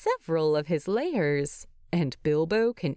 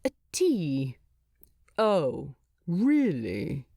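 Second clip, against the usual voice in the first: sarcastic; 3 semitones lower, slower than usual. A narrator's reading. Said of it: "his sarcastic tone to himself".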